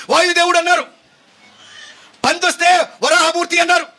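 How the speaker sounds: high, sustained proclaiming voice; full-force preaching. Acted. Preacher proclaiming Paul's message to idol worshippers, full-force preaching. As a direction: cry out each phrase high and drawn to the crowd.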